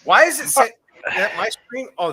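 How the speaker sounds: ordinary talk, nothing marked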